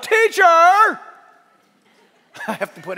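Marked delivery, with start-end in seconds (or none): 0.0-1.0 high, loud heckling "teacher"; hammed-up Pharisee shout; disliked Pharisee's voice. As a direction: call it out shrilly from the crowd, mocking them. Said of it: "I have to say it that way".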